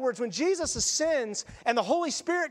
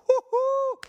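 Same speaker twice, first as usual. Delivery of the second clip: much higher, loud whoop; whooping cheer; 12 semitones higher, 5 dB louder than usual. Retold or imitated. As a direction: cheer like the victory has come. Crowd cheering Jesus' arrival.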